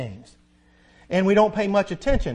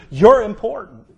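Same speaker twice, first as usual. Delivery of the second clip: forceful declaration; 2 semitones higher, 10 dB louder than usual. Real, not acted. Preacher affirming the congregation.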